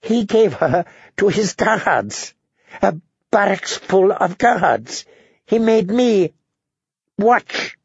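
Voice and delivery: high, slow, choked anguish; choked-up outrage, pitched high. A narrator's reading. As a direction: force each phrase out through a tight throat. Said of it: "Tyrion's voice was choked".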